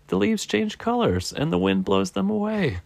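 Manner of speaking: high-pitched squirrel voice; realizing Squirrel. Acted. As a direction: parrot the explanation in a high squirrel voice. Squirrel repeats Bird's explanation.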